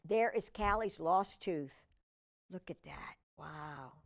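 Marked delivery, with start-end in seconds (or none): 2.5-3.9 much softer, lower hush so nobody wakes; whisper-soft awe at the sleeping cat. Real, not acted. Hushed, cat is asleep.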